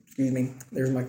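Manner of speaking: normal talking voice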